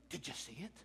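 very soft, low mock question; hushed eager question. Football fan coming up to the pastor, hushed question. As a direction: ask it in a hush, imitating someone. Voices fans coming up after game.

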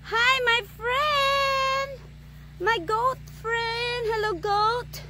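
slow, high sing-song greeting; sweet greeting. Real, not acted. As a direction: coo hello to the goat.

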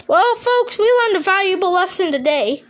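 normal talking voice

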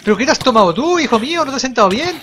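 high, loud disbelieving scold; exasperated shout. Real, not acted. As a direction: yell at the monster in disbelief.

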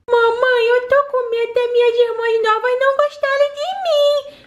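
ordinary talk, nothing marked

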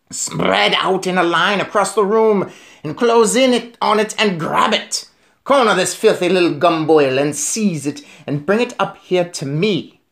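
high, commanding witch screech; screaming Grand High Witch. A narrator's reading. Witch leader ordering her followers from the platform, screaming. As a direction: scold them in a high witch voice, drawing out the end. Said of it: "screamed the Grand High Witch".

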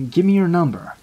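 flat, cold, emotionless demand; cold monotone voice. Retold or imitated. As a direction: say it cold and dead flat, falling at the end. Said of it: "in the most cold, monotone voice".

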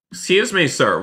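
high-pitched "excuse me", dropping on "sir"; calling out. Pretend. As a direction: call for a stranger's attention, insistently. Calling to another player.

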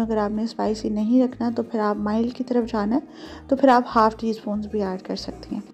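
ordinary talk

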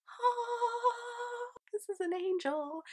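high, drawn-out heavenly "ah"; awed gasp. Retold or imitated. Fan retelling her amazement at the volunteer's offer, awestruck. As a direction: marvel in a high voice, as if seeing an angel.

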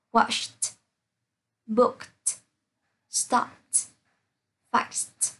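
"watched", "booked", "stopped", "fixed" each ending in a released t; word by word, ED in "stopped" and "fixed" said as t. Shown as a demonstration. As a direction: pop a voiceless t at the end of "watched" and "booked". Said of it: "một số từ được phát âm là T".

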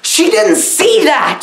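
usual speaking voice

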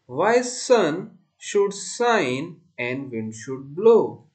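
slow, deliberate verse with stretched words; poem recitation. Shown as a demonstration. Teacher reciting a poem for class four, slow deliberate recitation. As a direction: recite it line by line, loud and measured.

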